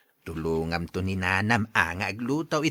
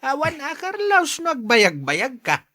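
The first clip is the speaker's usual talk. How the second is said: excited; 12 semitones higher, 5 dB louder than usual. Acted. Father teasing Carlos excitedly.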